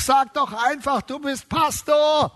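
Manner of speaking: a hint of a nudging inner voice, urging; Holy Spirit voice. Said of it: "der Heilige Geist ... sagt".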